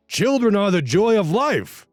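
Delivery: high, gushing pushy relative; hammed-up high-pitched insistence; insistent uncle voice.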